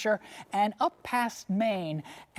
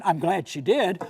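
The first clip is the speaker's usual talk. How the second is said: ordinary talk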